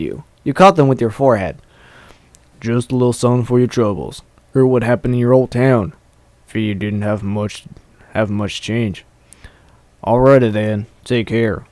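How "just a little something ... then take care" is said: low, soft, unhurried big fellow; big farm stallion, kindly. Acted. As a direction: speak quietly and kindly in a deeper voice.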